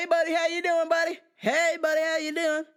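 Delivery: high, loud goofy buddy voice; annoying customer. Retold or imitated. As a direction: chirp it like an overeager regular. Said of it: "always calls me buddy".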